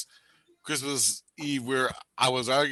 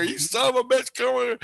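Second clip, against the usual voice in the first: high-pitched mock-angry yell; acted angry order; 10 semitones higher than usual. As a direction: play an angry captor hauling someone along. Acted angry grab and drag.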